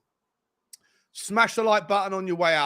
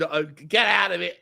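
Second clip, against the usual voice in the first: ordinary delivery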